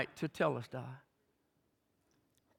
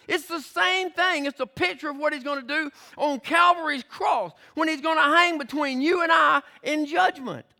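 climactic preaching; 8 semitones higher than usual. Real, not acted. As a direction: build to the sermon's peak.

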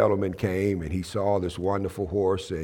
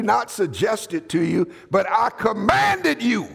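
building sermon; 8 semitones higher than usual. Acted. Building preaching.

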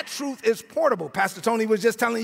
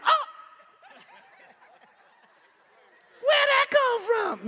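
high, slow, shouted fake surprise; mock innocent surprise; 12 semitones higher, slower than usual. Preacher mocking a believer faking surprise, high and slow. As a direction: act shocked like the curse word came from nowhere. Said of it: "don't act like".

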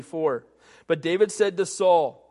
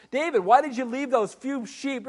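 lightly voiced sneering older brother, higher; mocking big brother; 5 semitones higher than usual. Mock sneering brother. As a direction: Eliab taunting David over the sheep.

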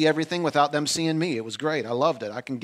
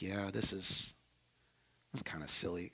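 sheepish admission; 7 semitones lower, 15 dB quieter than usual. Retold or imitated. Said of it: "And I was like".

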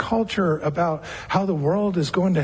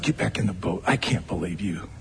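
scolding; 13 semitones lower, 5 dB quieter than usual. Retold or imitated. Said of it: "I don't hear it as a scolding".

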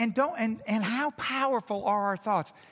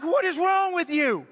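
much higher, louder exasperated retort; 10 semitones higher, 5 dB louder than usual. Retold or imitated. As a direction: throw up your hands in disbelief. Exasperated at panicking wife.

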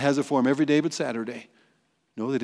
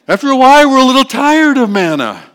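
loud, high whiny grumble; hammed-up people fed up with manna; mock complaint; 10 semitones higher, 14 dB louder than usual. Preacher voicing Israelites fed up with manna, loud mock whine.

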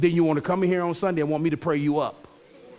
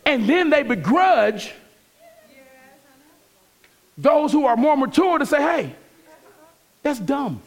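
louder, higher preaching push; 8 semitones higher, 3 dB louder than usual. Acted. Pastor exhorting the church about correction, fervent preaching. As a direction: drive the point home from the pulpit.

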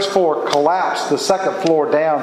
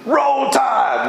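fan cheer; 9 semitones higher than usual. Acted. Preacher imitates a fan for the congregation, high cheering shout. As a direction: holler it like a proud football fan.

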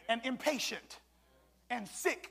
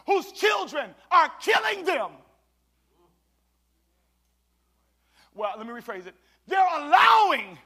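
loud, rising preacher's call; preaching at full force; 4 semitones higher, 8 dB louder than usual. Acted. Pastor calling the mothers to come to the Lord, preaching loud. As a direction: preach it hard to the congregation.

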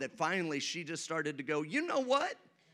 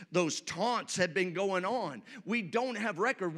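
ordinary delivery

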